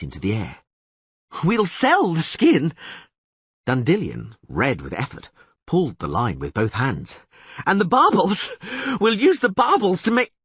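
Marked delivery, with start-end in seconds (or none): 1.4-2.7 put-on high, eager, excitable young bard; higher and louder, thrilled by the catch; excited bard voice. Dandelion marvels at the huge catfish, high and excited. 7.7-10.3 put-on high, eager, excitable young bard; higher and louder, thrilled by the catch; excited bard voice. Dandelion marvels at the huge catfish, high and excited.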